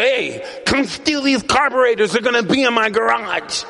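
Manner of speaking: high, put-on taunting voice calling out; mock taunt. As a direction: bait the old bully cheekily. Teasing rival about carburetors.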